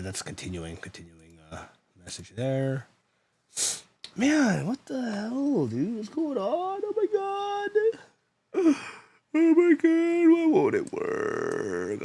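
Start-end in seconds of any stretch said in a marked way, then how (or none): 4.1-8.8 high, drawn-out frustrated whine; whiny despair. Real, not acted. Frustrated at failing stream software. 9.3-12.0 high whine sliding slowly down; despairing wail. Real, not acted. Frustrated at failing stream software.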